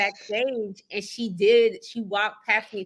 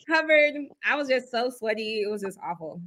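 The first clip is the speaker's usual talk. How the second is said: ordinary talk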